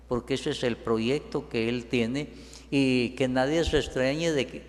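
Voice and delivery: usual speaking voice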